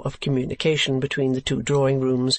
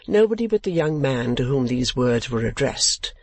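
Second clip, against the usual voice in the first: ordinary delivery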